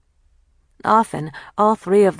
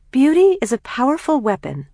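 high, louder, grandly theatrical reading; sarcastic yet enthralled; 9 semitones higher, 5 dB louder than usual. A narrator's reading. Kathleen reads the magazine to her sisters, sarcastic and enthralled. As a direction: relish the line while mocking it. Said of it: "at once sarcastic and enthralled".

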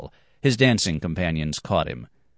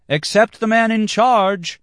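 much higher, louder, slow outcry; cry out; 6 dB louder than usual. Acted. Dancer cries out from the dance floor, high and loud. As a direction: call it out mid-dance. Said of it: "cried out a dancer".

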